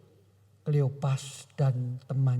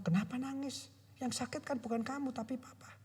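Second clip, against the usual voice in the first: soft, tender father; 9 semitones higher, 10 dB quieter than usual. Retold or imitated. Father comforting his crying son.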